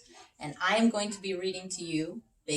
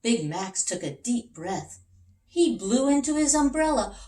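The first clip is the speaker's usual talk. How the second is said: put-on reading to children; 7 semitones higher, 6 dB louder than usual. A narrator's reading. Reads picture book to children.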